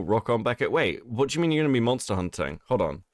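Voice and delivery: ordinary talk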